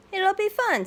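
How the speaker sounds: sweet chirpy mom; high, happy mother bear; cheerful Mama. Acted. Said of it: "says Mama".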